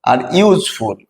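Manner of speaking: ordinary delivery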